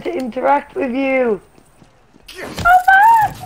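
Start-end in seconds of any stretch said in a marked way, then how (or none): 2.4-3.4 high, loud call after him; yelling. Real, not acted. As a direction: shout it out after someone running off. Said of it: "Come back, Derek".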